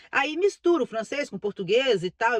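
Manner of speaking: ordinary delivery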